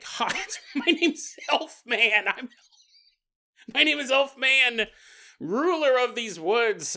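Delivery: high, put-on elf declaration; mock heroic elf. Elf in a tree to orc army, mock-heroic announcing. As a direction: announce yourself as a silly woodland hero.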